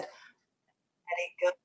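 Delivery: a quiet inner voice letting go, slow; soft inner voice. Retold or imitated. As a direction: hush the worry, slowly. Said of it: "this other voice said".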